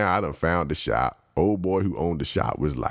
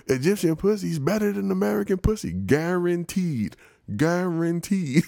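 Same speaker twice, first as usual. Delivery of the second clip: hammed-up hustler pitch; 12 semitones higher than usual. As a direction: sell it hard, stretching each guaranteed.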